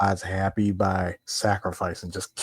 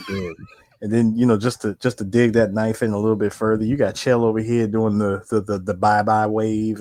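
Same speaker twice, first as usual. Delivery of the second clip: usual speaking voice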